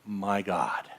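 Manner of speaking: soft, low, slow exhausted sigh of a voice; weary despair. Retold or imitated. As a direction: give up, drained. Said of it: "when you're going".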